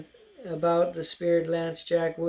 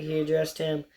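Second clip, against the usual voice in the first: ordinary delivery, nothing marked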